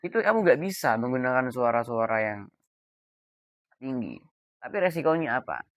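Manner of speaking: usual speaking voice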